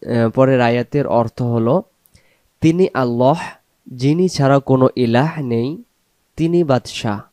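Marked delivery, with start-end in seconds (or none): none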